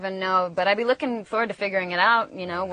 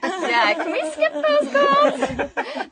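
laughing; 5 dB louder, much slower than usual. Real, not acted. Laughing after an awkward call.

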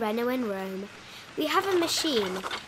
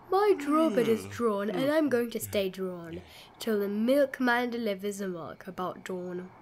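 put-on drowsy old keeper lightly yawning his words; yawning drawbridge drawer; 3 semitones lower than usual. A narrator's reading. Drawbridge drawer announcing bedtime, mild sleepy yawn. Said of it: "just said with a yawn".

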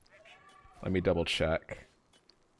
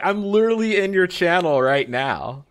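high, loud replay of an outburst; mock outraged protest; 10 semitones higher, 9 dB louder than usual. Streamer re-enacting being in your channel right now, mock protest. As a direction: push it high and loud, hammed-up. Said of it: "I'm like".

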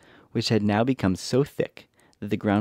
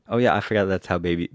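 ordinary delivery

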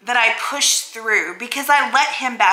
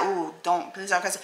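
soft prissy plea; prissy pleading; 3 semitones lower, 8 dB quieter than usual. Retold or imitated. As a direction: mimic a prim scold. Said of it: "you're like".

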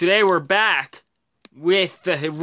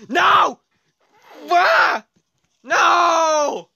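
high-pitched yells of dismay; high, drawn-out cries of protest; yelling; 9 semitones higher, 4 dB louder, much slower than usual. Real, not acted. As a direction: yell out as the lead slips away. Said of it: "Why am I yelling?".

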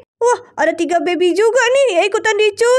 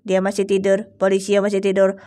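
much lower character voice; sidekick voice; 12 semitones lower, 3 dB quieter than usual. Pretend. Sidekick reports on the sleeping fake policeman, low voice.

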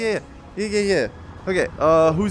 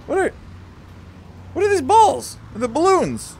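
baffled outburst; 11 semitones higher than usual. Real, not acted. Player spotting unknown balls in game, high baffled outburst. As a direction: blurt it high in bewilderment.

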